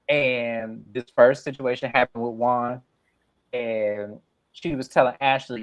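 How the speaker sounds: normal talking voice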